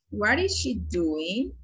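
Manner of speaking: moderately slow, connected classroom question; careful but flowing repeat. Shown as a demonstration. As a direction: run the words together, still measured. Teacher repeats question more fluently.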